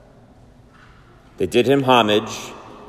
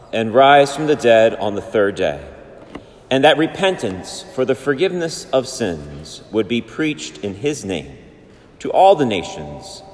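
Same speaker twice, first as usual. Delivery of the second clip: Gospel reading; 3 semitones higher than usual. Real, not acted.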